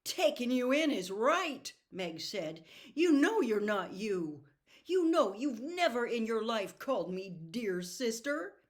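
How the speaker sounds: high, loud, indignant; angry retort. Acted. Meg furious at her possessed brother, loud and high. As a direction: throw it back at him with fury.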